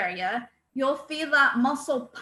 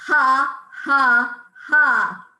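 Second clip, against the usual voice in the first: three loud punched belly-laugh has; 7 dB louder, much slower than usual. Shown as a demonstration. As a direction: pump out each ha from the diaphragm. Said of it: "Number one".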